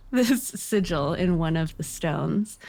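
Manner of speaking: normal talking voice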